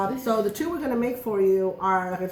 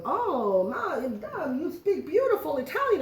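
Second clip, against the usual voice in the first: much higher, lightly Italian waiter voice; imitating the waiter; 7 semitones higher, slower than usual. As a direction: gush like a charmed waiter. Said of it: "the waiter goes".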